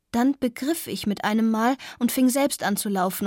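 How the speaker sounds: plain reading voice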